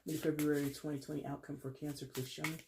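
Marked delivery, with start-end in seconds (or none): none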